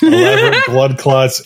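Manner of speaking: laughing. Real, not acted.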